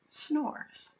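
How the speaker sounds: don't wake the bear; whispering. A narrator's reading. Sleeping bear refrain.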